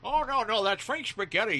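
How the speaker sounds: loud, high-pitched dad impression; joking dad. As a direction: correct the kid with comic bluster. Dad answers kid in acted exchange.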